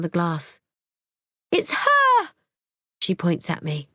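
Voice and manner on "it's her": very high pointed cry; frantic high accusation; accusing shout. Acted. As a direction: yell it at her. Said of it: "She's shouting".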